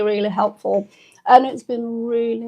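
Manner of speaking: ordinary talk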